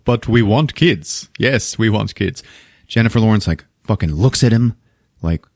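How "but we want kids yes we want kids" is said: slow insistent. Retold or imitated.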